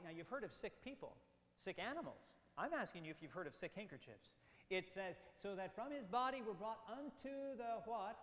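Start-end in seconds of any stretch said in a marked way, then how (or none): 5.4-7.8 raised, louder reading that stops hanging on "unto the"; building suspense. Shown as a demonstration. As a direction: read "brought unto the" high and leave it open. Reading verse up to the surprise.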